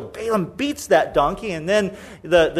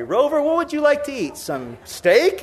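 indulgent dog owner; 7 semitones higher than usual. Acted. Mock indulgent owner talking to dog.

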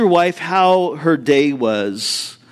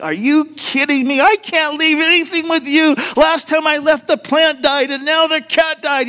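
high, exasperated mock rant; irate wife; 8 semitones higher than usual. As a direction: play the furious wife, up high. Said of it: "The wife just was irate".

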